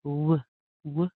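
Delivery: "w" as short rounded "wuh"; isolated "w", lips rounded, nothing touching; W sound demo. Shown as a demonstration. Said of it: "using a W where you're rounding".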